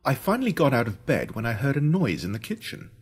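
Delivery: usual speaking voice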